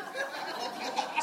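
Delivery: silent high wheezing laugh; a soundless giggle he can't hold in; breathless laugh. Real, not acted. Pastor laughing at his own name joke, wheezy and breathless.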